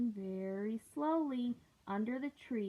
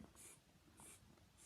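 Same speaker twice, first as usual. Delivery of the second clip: tsk tsk; 29 dB quieter than usual. Acted. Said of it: "stamped their feet back at him and said".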